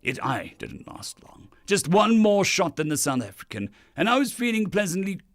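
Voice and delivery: declaimed first-person tale in a lofty character voice; proud alien drinking champion. Acted. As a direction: boast like a galactic champion telling his tale.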